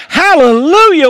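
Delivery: hallelujah ringing out high and loud, then falling; hallelujah shout. Real, not acted. Pastor calling out praise to the congregation, shouted. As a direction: cry out the praise.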